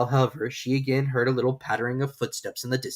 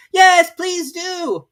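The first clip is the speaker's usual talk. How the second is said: very slow, high, loud group plea; chorus call; 12 dB louder, much slower than usual. Acted. Animals joining in chorus, loud and slow. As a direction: call out together. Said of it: "joined chorus".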